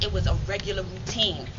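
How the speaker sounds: normal talking voice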